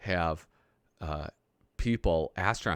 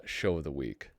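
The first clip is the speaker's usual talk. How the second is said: ordinary talk, nothing marked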